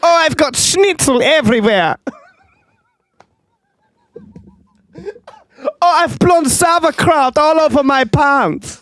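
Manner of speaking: hammed-up German sneezing man, very high; a thick mock German accent in a squeaky voice; silly cartoon German accent. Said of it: "that fucking German accent".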